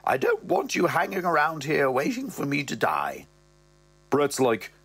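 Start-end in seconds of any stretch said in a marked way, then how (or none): 0.0-3.3 higher mother voice; mother's voice. Acted. Mother sending Brett home, higher voice. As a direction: lift into the mother's voice. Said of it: "she goes".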